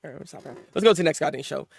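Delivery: normal talking voice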